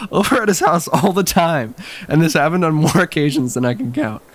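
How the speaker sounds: ordinary talk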